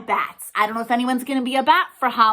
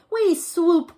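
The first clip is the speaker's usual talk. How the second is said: eager little bats, a bit higher and louder; little bats crying out; 7 semitones higher, 6 dB louder than usual. A narrator's reading. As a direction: answer back like excited babies. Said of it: "cried the nine".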